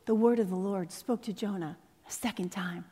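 soft unvoiced whisper; whispered refrain. Shown as a demonstration. Preacher whispering the refrain to the congregation, hushed whisper. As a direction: breathe it like a secret to the congregation.

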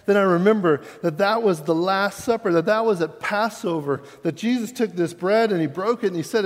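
fervent sermon climax; preaching at full force. Acted. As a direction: preach it, swelling toward the resurrection.

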